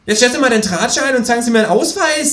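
fast, high and bossy; kangaroo voice. Acted. Kangaroo bossing the policeman, fast and high. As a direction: rattle it off high and impatient. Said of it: "sagt das Känguru".

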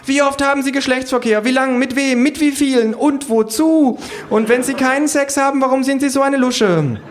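shrill, relentless pollster yelling; shrieked questioning. A narrator's reading. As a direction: shriek survey questions at a fleeing victim. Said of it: "Von ihrem Geschrei angelockt".

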